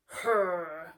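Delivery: loud effort grunt; loud strongman grunt; strain grunt. Acted. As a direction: heave with a grunt. Muscle strain.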